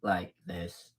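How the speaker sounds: the deep voice she warns against. Shown as a demonstration. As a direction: sink right down to a deep masculine pitch. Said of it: "sounds like this, like this".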